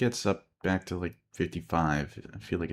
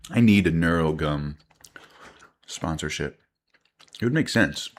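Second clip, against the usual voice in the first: chewing gum; 6 dB louder than usual. Real, not acted. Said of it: "Sorry that you now hear me chewing gum".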